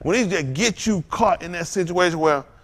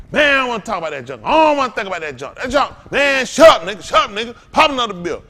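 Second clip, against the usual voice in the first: loud, higher, fast brush-off; dismissive drunk shout; 7 semitones higher, 8 dB louder than usual. Retold or imitated. Preacher acting out his drinking self refusing to listen, shouting. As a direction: shout it down, shut up, pass the bottle. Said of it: "I used to be like that".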